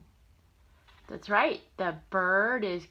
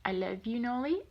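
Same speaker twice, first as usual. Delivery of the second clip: ordinary delivery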